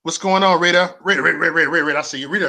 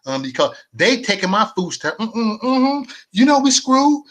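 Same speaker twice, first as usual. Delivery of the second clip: higher, sassy caricature voice, whining about food stamps; mock welfare recipient; 6 semitones higher than usual.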